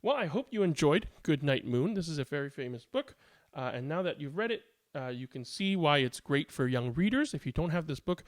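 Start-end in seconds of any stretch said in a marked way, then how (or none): none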